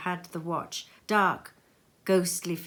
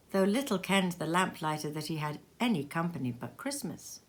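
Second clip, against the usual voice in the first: usual speaking voice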